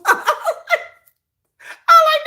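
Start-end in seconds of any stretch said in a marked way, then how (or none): 0.2-0.9 quick high burst of laughter. Real, not acted. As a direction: squeal a short laugh.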